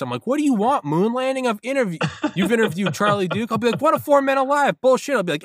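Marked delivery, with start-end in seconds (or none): 0.3-3.5 very high, exasperated comic rant; mock outraged rant. Podcaster boasting of past guests to assassins, mock outraged. 3.8-5.1 loud, very high comic shouting; mock outraged yell. Quarrel between podcaster and assassin, mock outraged.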